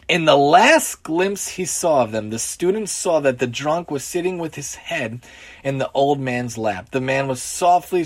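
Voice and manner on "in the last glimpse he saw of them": usual speaking voice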